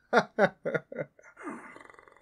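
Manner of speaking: loud, high, menacing laugh; evil laugh. Acted. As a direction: laugh at your prey. Gloating over captor.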